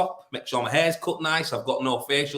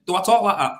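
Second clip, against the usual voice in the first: louder, higher retold challenge; indignant challenge; 5 semitones higher, 7 dB louder, faster than usual. [name] confronting co-worker who mimicked him, indignant challenge. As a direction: throw the question back forcefully. Repeats the challenge more forcefully.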